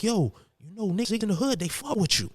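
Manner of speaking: high-pitched Puerto Rican gangster buddy; gangster friend impression. Gangster friend pitching a YouTube channel name, high-pitched impression. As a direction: pitch way up, imitating an excited pal. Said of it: "one Puerto Rican guy who's a gangster".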